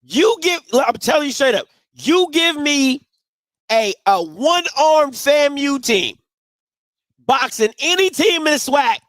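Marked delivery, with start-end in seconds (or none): none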